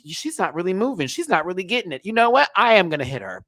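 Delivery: mock deliberation building to a decision; Stassi. Stassi deciding whether to hit her, mock deliberation. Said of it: "Stassi had time to think about it".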